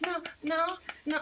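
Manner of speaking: a hint of panicky refusal; flustered refusal. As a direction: beg off singing, embarrassed. Refusing to sing, retold.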